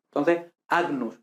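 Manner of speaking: agnus with a hard G then N, slowly. Shown as a demonstration. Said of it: "ojo, no ñ".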